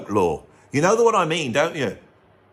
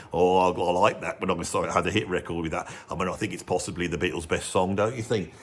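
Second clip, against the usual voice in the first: [name] voice; 9 semitones lower, 5 dB quieter than usual. Retold or imitated. [name] voiced defending his song.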